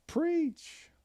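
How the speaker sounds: said through a yawn; mid-yawn word. Real, not acted. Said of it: "I'm yawning again".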